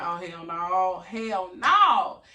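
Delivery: loud, rising hell no, hell no; panicked loud no building up; alarmed refusal. Retold or imitated. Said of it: "I'm like".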